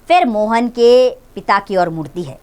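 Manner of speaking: ordinary delivery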